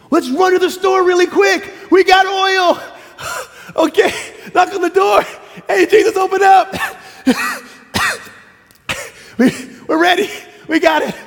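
high, loud, laughing panic; frantic rush. Acted. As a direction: scramble in at the last second. Believers rushing to heaven's door.

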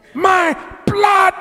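high, loud, climbing preacher's cry; fervent pulpit preaching rising to a shout. Acted. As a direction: build the congregation toward a shout. Preaching at full force.

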